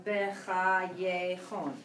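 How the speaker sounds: slow, each syllable spaced; slow model word. Shown as a demonstration. Teacher slowly models the word for a student. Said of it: "Make sense?".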